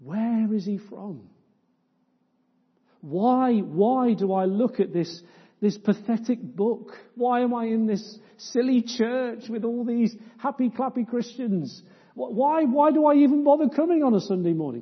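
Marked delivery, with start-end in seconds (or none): 3.0-14.8 a hint of an exasperated doubter, rising to fast; voiced doubter. Preacher voicing a visitor's doubts, mild exasperation. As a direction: ask it like the doubting visitor in the pew.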